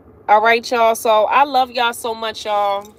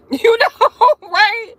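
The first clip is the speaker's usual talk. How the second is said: words bubbling through real laughter, pitched up; laughing; 10 semitones higher, 5 dB louder, slower than usual. Real, not acted. Laughing to her viewers at how crazy it is. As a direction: laugh it out as you ask. Laughing after retold quote.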